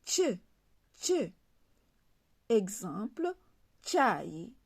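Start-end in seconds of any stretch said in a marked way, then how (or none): 0.1-1.3 "tche" with a clear ch sound, like church; letter C said as "tche"; Comorian letter C. Shown as a demonstration. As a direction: say "tche" as tch, never as s. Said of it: "La lettre C se lit tche".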